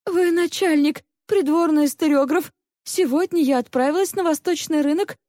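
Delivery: high, earnest female character voice; pleading servant woman. A narrator's reading. Maid explains her market errand to interrogators, high and pleading.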